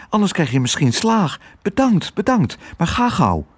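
put-on high, hurried child voice; high-pitched, urgent gratitude; grateful girl. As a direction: thank him and shoo him off. Girl thanks and hurries dog.

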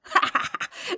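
high, breathy acted laughter; scoffing laugh. A narrator's reading. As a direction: laugh off the boast. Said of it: "moest er zelfs om lachen".

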